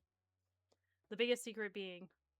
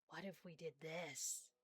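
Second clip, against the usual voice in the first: slow, lower pondering voice; brain's inner voice; 4 semitones lower, 7 dB quieter, slower than usual. Retold or imitated. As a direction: muse it slowly, like your own brain thinking aloud. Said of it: "my brain went".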